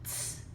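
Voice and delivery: short unvoiced ts; crisp tiny t sound; teacher modeling the t sound; letter sound t. Shown as a demonstration. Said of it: "the T says".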